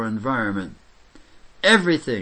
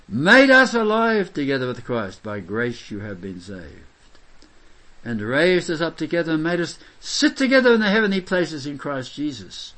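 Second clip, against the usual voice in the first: scripture declaimed; 3 semitones higher, 3 dB louder than usual. Acted. Scripture read with force.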